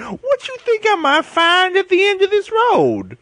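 put-on high, slow old prospector drawl. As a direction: drawl it like a grizzled miner. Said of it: "you're an old prospector".

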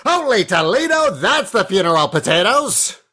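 put-on high, fast old-time radio announcer; 1920s announcer. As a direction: belt it like a vintage newsreel voice. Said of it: "a nineteen twenties announcer would say".

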